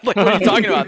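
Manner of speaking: high, loud and laughing; mock outrage with a laugh; incredulous. To the weed buyers, high laughing disbelief.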